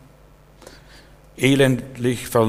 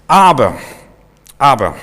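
loud objection; 8 dB louder than usual. Retold or imitated. Preacher shows how the spies' "aber" turned the people, shouting "aber". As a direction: hurl the word "aber" like a verdict. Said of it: "was so ein Wörtchen aber auslösen kann".

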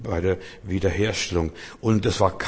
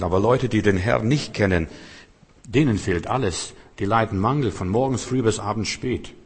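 ordinary delivery

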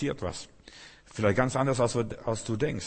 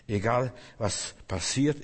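ordinary talk, nothing marked

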